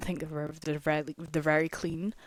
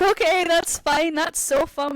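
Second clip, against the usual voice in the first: retold nervous cheer; 9 semitones higher, 4 dB louder than usual. Retold nervous reaction on a ride.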